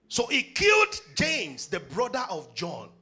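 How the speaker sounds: slow, loud stressed reading; emphatic reading. Acted. Preacher reading the verse to the congregation, slow and emphatic. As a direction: weigh each word of the verse. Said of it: "repeated emphatic reading".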